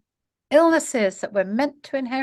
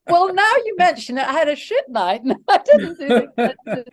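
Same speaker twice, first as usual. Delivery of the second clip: higher, louder, breaking into laughter; laughing mock oversharing; 5 semitones higher, 5 dB louder than usual. Acting someone who answers "how do you do?" honestly, laughing. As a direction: play an eager oversharer, laughing through it.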